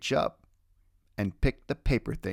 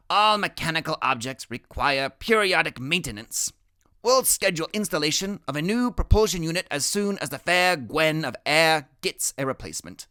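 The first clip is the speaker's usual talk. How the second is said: higher, precise and formal; boy genius voice; 5 semitones higher, 4 dB louder than usual. Acted. Boy genius explains repairs to friend, formal and higher. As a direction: announce it like a technician's report. Freak after elastic breaks.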